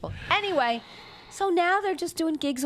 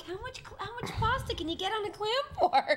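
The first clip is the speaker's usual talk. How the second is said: incredulous hushed quote; 8 semitones higher, 8 dB quieter than usual. Retold or imitated. Mocking husband eating pasta.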